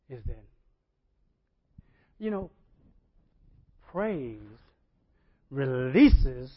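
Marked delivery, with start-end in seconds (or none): 3.9-6.5 slow, loud emphatic preaching. Acted. Preacher proclaiming the force of praise, loud and slow. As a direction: lean on each word.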